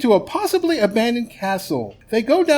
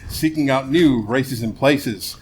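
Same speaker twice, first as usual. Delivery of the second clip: show narration; 6 semitones lower than usual. Acted.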